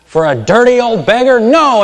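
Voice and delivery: high, loud scandalised refusal; old lady slamming the door on a beggar, loud; indignant shout. Retold or imitated. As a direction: shriek it like an outraged landlady. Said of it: "And she said".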